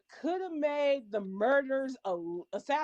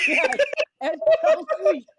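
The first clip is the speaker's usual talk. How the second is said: mocking echo; 8 dB louder than usual. Mocking a friend's remark about Eddie, high, loud and fast.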